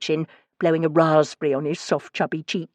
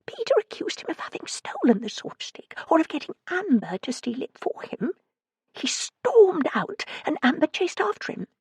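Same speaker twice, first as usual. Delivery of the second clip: frightened voice; 12 semitones higher than usual. A narrator's reading. Frightened caller on phone.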